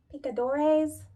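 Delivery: ordinary talk, nothing marked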